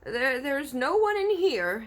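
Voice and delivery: frightened giant stammering; stammered. A narrator's reading. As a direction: stumble over the lie. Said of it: "he stammered".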